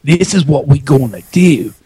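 hammed-up Australian "crikey" voice; playful Australian impression; put-on Australian accent. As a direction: switch into a mock Australian accent. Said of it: "let me change it up".

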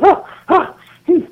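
high, huffy "huh"s imitating a disgusted woman; mock scornful huffs. Annoyed coworker whisking by the jokers, imitated scornful huffs. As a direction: huff in mock disdain. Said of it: "rolling her eyes and shrugging".

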